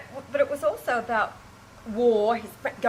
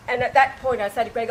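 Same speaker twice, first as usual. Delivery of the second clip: ordinary delivery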